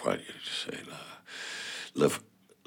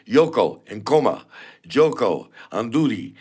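"Yoko", "Nkoma", "Joko", "Anduri" each hit hard on the first syllable, higher and louder; first-syllable stress; 6 semitones higher, 5 dB louder than usual. Shown as a demonstration. Rapper shows French listeners African names, stress on first syllable. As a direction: punch the opening syllable of every name. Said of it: "je dis le mot comme ça".

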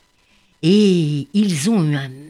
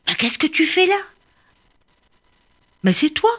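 high, bright surprise at finding a friend; surprised little bird; 11 semitones higher than usual. Acted. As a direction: gasp of discovery. Surprised bird meets Malou.

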